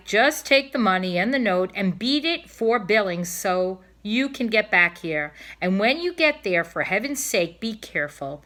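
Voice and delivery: a wife sternly lecturing her husband before his trip; nagging wife. Acted.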